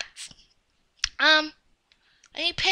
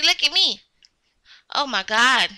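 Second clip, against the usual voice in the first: normal talking voice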